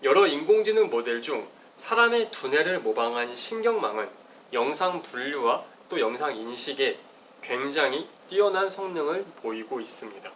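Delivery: ordinary delivery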